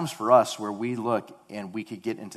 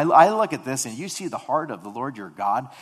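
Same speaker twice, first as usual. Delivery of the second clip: usual speaking voice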